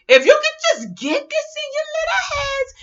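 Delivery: very high-pitched squeally plea; mock pleading. Real, not acted. Pleading with listeners to get it, high mock squeal. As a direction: squeal it at them in mock exasperation.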